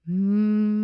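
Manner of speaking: steady closed-mouth hum demo; bumblebee hum. Shown as a demonstration. Teacher showing the class the humming bee breath, steady hum. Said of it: "steady humming sound like a bumblebee".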